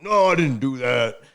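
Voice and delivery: slow, loud, put-on dad denial; dad denying. Imitating his dad denying the abuse, mild mock. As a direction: play the dad brushing it off. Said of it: "He's like".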